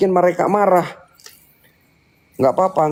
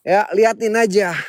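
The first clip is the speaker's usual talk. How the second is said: normal talking voice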